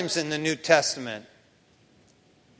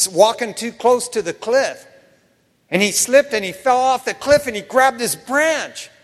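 usual speaking voice

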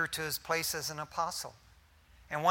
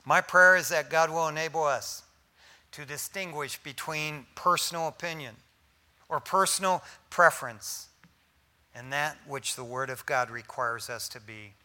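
ordinary talk, nothing marked

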